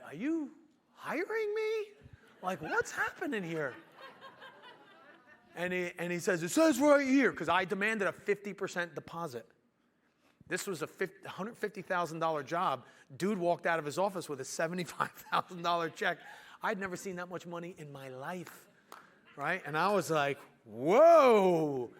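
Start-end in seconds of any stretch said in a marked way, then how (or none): 0.1-3.7 squeaky-high mock incredulity; baffled disbelief. Young contractor realizing the angry client hires him, mock bewilderment. Acting out his bewilderment at hire. 6.4-7.3 loud, high imitated retort; impatient insistence. Client answering confused young contractor, loud retold impatience. 20.6-21.9 loud, drawn-out whoa; amazed whoa. Retold or imitated. Young contractor seeing the huge check, loud retold amazement.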